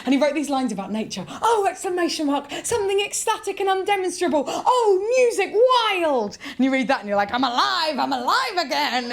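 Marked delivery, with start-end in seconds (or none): 1.4-6.4 high, ecstatic mock recital; Walt Whitman. Comic reading a poet's nature lines, wildly enthusiastic. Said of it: "the most enthusiastic person who ever". 7.3-9.1 high, exclaimed joy; elated. Acted. Reader revived by an ecstatic nature poem, elated.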